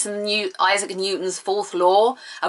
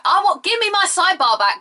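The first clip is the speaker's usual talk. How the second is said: exasperated complaint; 11 semitones higher than usual. Real, not acted. Ranting about phone update.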